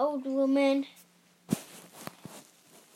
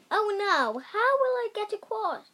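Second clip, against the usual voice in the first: higher, anxious little voice; worried cry; 7 semitones higher than usual. A narrator's reading. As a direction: fret at the river's edge. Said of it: "he cried".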